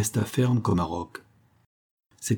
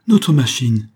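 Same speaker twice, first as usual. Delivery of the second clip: louder, joyful exclamation; radiant cry; 4 semitones higher, 8 dB louder, slower than usual. Acted. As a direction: cry out in delight at the sight. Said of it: "s'écria Nicolas et Charles radieux".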